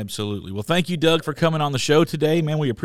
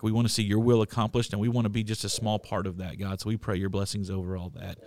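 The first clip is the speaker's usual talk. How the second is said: low, soft praying voice; earnest prayer; 7 semitones lower, 8 dB quieter than usual. Real, not acted. Host prays over an upcoming church trip, earnest prayer. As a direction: pray quietly, letting the phrase ends sink.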